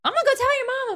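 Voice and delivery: very high-pitched mock threat; high scolding neighbor voice; tattling neighbor. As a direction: threaten like a nosy Southern neighbor. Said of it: "called out by a neighbor real fast".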